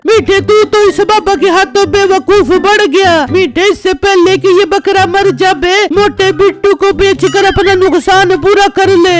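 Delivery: wife's voice, complaining. Acted. Wife scolds and advises. (पत्नी की ऊँची आवाज़, शिकायत भरी)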